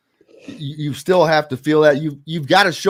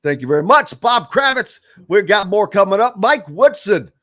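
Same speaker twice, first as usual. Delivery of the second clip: louder, higher announcer delivery; radio host sign-off; 5 semitones higher, 5 dB louder than usual. Real, not acted. Host thanks guest and teases next segment, punchy announcer style.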